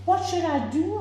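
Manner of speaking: high, worried plea; a frightened father's high question; anxious high question. A narrator's reading. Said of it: "asked Mister Hope".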